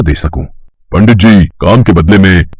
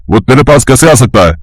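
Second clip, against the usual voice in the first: ordinary delivery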